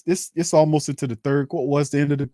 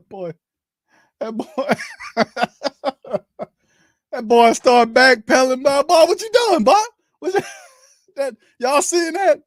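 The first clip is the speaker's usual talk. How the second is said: laughing; 9 semitones higher, 4 dB louder than usual. Real, not acted. Laughing at lineman's blunder.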